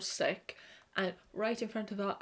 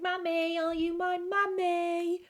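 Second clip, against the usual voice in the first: put-on high, childlike eerie plea; high creepy child asking for mummy; creepy kid voice; 9 semitones higher, 4 dB louder than usual. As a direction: ask like a lost ghost child. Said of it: "the kid is knocking the door and going".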